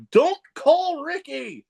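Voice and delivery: high, drawn-out mock heckle; retold yell. Heckler beats ring announcer to sponsor slogan, imitated high yell. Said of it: "I just yell out".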